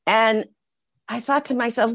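ordinary delivery

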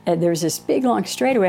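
ordinary talk

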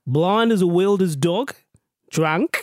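slow put-on Aussie reading; imitation Australian accent. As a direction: draw the slang out in mock Australian. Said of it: "do this in an Australian accent".

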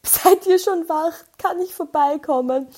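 calling grandma on the phone, sweetly. Retold or imitated. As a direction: coax grandma gently. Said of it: "war so".

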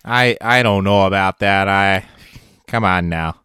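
mock Canadian, drawn-out and folksy; Canadian accent. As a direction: put on a hammed-up Canadian drawl. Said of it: "two sentences of Canadian".

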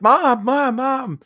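high, drawn-out mock scream; imitated screaming. Daughter at her burnt-out trailer, imitated screaming for her mother. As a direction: cry out for Mom in mock panic. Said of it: "she's just screaming".

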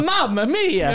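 high hammed-up "Mamma mia"; mock Italian. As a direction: exclaim it like a cartoon Italian chef. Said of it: "pretend like I'm from Italy".

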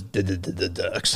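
stuttered name, slowly. Shown as a demonstration. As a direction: stammer on the D. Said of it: "I had a chronic stutter".

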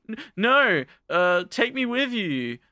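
high, drawn-out mock plea; acted panicky begging; pleading. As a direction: beg not to be left behind, hammed up. Acted plea not to be left.

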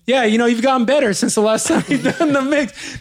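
imitating Mickey's backhanded compliment; mock Mickey. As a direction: give faint praise playfully. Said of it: "Mickey's saying".

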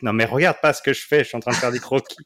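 playful scolding. Retold or imitated. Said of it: "pour cacher des trucs".

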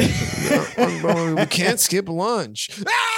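high, slow whine rising to a scream; tantrum-throwing child. Retold or imitated. Comedy fan imitating childish sketch character, whine building to scream. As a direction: drag out the complaint, then shriek. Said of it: "impressions of five to eight-year-olds".

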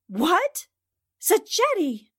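high, loud startled outburst; shocked cry. Acted. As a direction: blurt out the name in alarm. Recognizes the gunman.

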